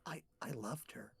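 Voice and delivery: wistful whisper; barely voiced, lovelorn murmur; hushed confession. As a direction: whisper it haltingly, like a painful admission. Gator confesses lost love.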